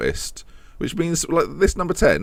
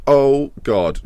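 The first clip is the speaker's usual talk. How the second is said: normal talking voice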